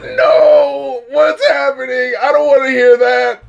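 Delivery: pained, laughing protest; mock horror. Real, not acted. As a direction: cry out like you can't bear it. Reacting to sleazy lyric.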